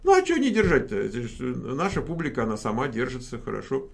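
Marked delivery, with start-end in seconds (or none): none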